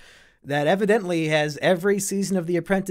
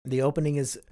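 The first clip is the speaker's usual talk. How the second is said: normal talking voice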